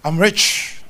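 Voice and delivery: loud, confident confession of wealth; firmer declaration. Shown as a demonstration. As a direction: declare it out with force. Builds the confession up.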